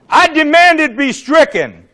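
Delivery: loud, high, slow demand; forceful demand. Retold or imitated. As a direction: call it out emphatically to the whole House. Retold formal demand on floor.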